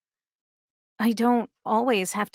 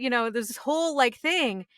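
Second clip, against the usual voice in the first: ordinary talk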